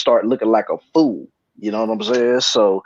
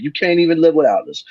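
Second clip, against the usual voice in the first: ordinary talk, nothing marked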